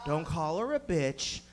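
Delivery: low, soft, slow self-warning; inner voice. Acted. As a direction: caution yourself quietly and slowly. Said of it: "in my head, I'm like".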